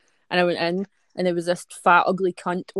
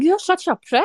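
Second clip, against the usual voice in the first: higher, louder mock outburst; retold exasperated retort; 10 semitones higher, 7 dB louder than usual. Telling listeners how they snapped at a new friend, mock exasperation. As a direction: throw the insult back, high and loud.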